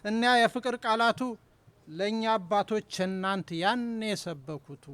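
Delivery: measured, declaimed Amharic poem reading; poetry recital. Acted. Poet reading his poem aloud to the audience, declaimed. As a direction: give each line its weight, pausing between phrases.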